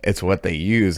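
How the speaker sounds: ordinary talk, nothing marked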